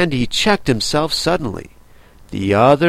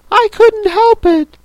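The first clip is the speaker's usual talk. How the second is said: high, loud sulky protest; 6 dB louder than usual. Acted. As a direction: whine it like a sulking child. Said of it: "said Five in a sulky tone".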